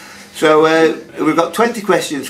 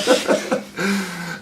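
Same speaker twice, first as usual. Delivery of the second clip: laughing; 4 semitones higher, 6 dB quieter than usual. Real, not acted. Quizmaster laughs.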